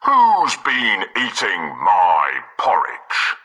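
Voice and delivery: deep slow papa bear; slow, booming big bear voice; Father Bear voice. Acted. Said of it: "Father Bear looked in his porridge bowl and said".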